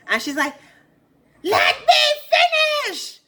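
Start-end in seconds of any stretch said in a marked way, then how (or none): 1.4-3.2 high, slow, shouted; shrill-free stretched shout of protest; mock yell. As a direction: scream it out over everyone, hammed-up. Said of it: "Who is this girl yelling at?".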